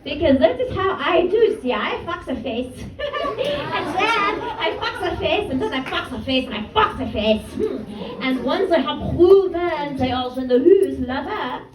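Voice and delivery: put-on French-accented raunchy storytelling; Ness. French friend boasts about her Hinge date, French accent. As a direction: savor each detail in a French accent. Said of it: "in my normal voice".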